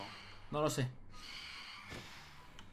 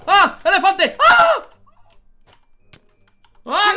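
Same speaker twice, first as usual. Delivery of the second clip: being chased; 6 semitones higher, 9 dB louder, much slower than usual. Real, not acted. Fleeing the ravager mob.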